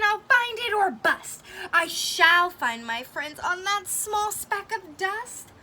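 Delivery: stubborn loud promise; determined cry. Acted. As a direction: refuse to give up, out loud. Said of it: "cried Horton".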